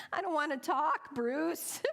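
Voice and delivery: mock-frantic protest to the loan officer; exasperated. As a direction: whine it like you've had enough. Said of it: "I thought".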